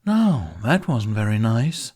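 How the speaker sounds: drawling tease. A narrator's reading. Jack taunting the captured Viking, drawling. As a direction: toy with him in a lazy drawl. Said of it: "Jack drawls".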